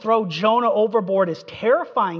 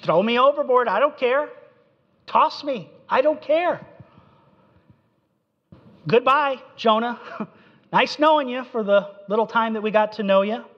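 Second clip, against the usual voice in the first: higher, reckless I-don't-care voice; careless bravado; 5 semitones higher than usual. Acted. Jonah telling the sailors to throw him overboard, recklessly careless. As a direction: toss it off like he doesn't care at all.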